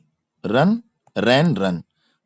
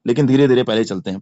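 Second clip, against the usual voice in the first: usual speaking voice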